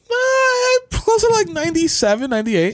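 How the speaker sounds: high shouted protest; excited shout. Real, not acted.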